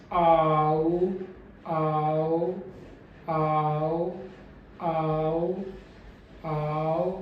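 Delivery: आओ gliding from open to closed mouth; closing diphthong demo. Shown as a demonstration. Teacher shows the closing mouth on आओ. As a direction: close the jaw through आओ. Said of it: "तब मेरा जो mouth है वो close होगा".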